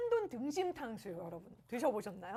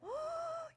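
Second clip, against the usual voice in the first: surprised gasp; 5 dB quieter than usual. Real, not acted. Gasps at smoke rising.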